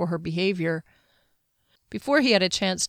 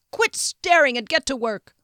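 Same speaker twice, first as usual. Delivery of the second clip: higher, louder barked order at his men; impatient hollering; 7 semitones higher, 4 dB louder than usual. A narrator's reading. Frederick hollering at his men to get to work, impatient. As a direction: holler at them to get moving. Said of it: "hollering impatiently".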